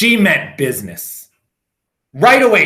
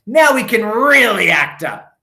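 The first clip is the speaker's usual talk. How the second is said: put-on gleeful kids; 7 semitones higher, 6 dB louder than usual. A narrator's reading. Kids gleeful about mischief.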